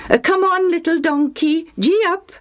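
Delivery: high cheerful gee-up; urging a donkey. A narrator's reading. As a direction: coax the donkey onward.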